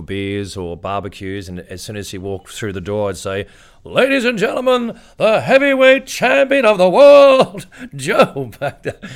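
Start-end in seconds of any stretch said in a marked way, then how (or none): 3.8-9.1 high, loud, slow boxing ring intro; ring announcer. Retold or imitated. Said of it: "I'd always announce him".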